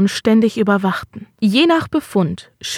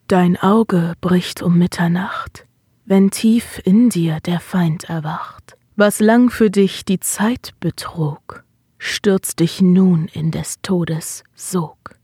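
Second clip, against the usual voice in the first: slow, whispered smoky incantation; rauchige Stimme; 2 semitones lower, slower than usual. Acted. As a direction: breathe it out like a trance chant. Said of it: "als eine rauchige Stimme aus den Tiefen ihrer Brust drang". (rauchige, langsame Flüsterstimme)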